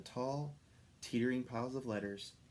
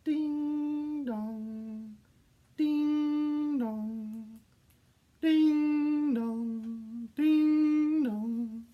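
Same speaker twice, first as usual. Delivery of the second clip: high, held ding dong building louder; bell chime; 7 dB louder, much slower than usual. A narrator's reading. Reindeer hearing church bells through the fog, chiming.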